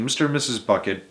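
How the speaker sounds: plain reading voice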